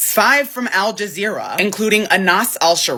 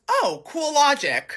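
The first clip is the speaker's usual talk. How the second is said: slow, higher mock-admiring comeback; sarcastic retort; 6 semitones higher, 3 dB quieter, slower than usual. Unsent comeback to the man at the bar, sarcastic. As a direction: feign being impressed, drawn out. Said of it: "what I wanted to say was".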